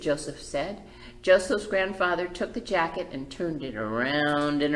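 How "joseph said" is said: ordinary delivery, nothing marked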